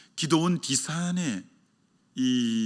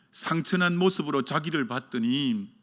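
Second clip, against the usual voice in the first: ordinary delivery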